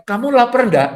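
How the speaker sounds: a lightly voiced woman fishing for a dinner invite; woman's hinting question. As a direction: ask while really hinting you're hungry. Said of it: "Tapi kalau wanita bilang".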